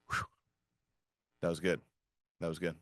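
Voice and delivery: breathless after a long stretch; catching breath between words. Real, not acted. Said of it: "A little out of breath".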